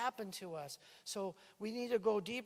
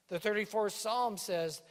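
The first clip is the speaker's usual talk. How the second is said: ordinary talk, nothing marked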